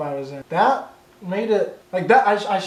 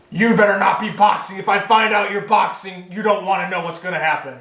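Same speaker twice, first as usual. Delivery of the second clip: imitating scolding relatives. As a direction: put on a warning, telling-off voice. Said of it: "people just, like, coming to me and".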